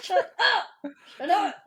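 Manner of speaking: high, loud mock protest through laughter; hammed-up tearful snapping; flustered retort. Crying girlfriend tells boyfriend off, mock indignant.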